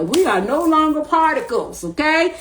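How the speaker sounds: high, eager proclamation; excited. Real, not acted. Telling her viewers they are waves, not particles, excited.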